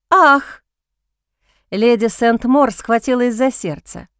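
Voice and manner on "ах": sharp startled gasp; shocked gasp. A narrator's reading. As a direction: gasp as if clutching the heart. Lady gasps in shock.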